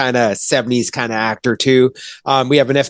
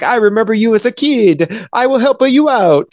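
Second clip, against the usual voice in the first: friendly cop imitation; 9 semitones higher than usual. Imitating cop talking to locals.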